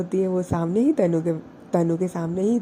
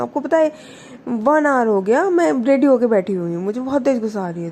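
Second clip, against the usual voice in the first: higher, louder angry complaint, fast at the end; raised angry voice; 6 semitones higher, 5 dB louder than usual. A narrator's reading. Prachi on the phone scolds Wani, raised angry voice. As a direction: scold her for not answering, voice raised. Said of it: "voice को raise करते हुए बोलती है".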